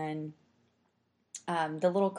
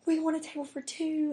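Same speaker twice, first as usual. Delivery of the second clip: sweet high ordering voice; 12 semitones higher than usual. Retold or imitated. Ordering at the magical cafe.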